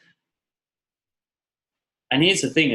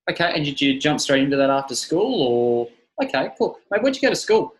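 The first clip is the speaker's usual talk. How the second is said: higher, bright, easygoing mate voice; friendly chat demo; 4 semitones higher than usual. Shown as a demonstration. Trainer showing friendly chat with a plumber client. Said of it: "plumbing example".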